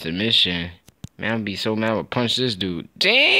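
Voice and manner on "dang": high, loud frustrated yelp; outburst. Real, not acted. Gamer after a bad miss, high loud yelp. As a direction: blurt it out in disbelief.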